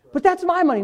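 mock complaint over money; lightly voiced stingy believer; greedy churchgoer. Voicing greedy objector.

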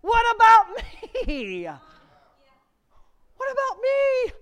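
high, loud pleading call; God calling out. Pretend. God standing by the worried believer, loud high plea. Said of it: "the answer's standing right there saying".

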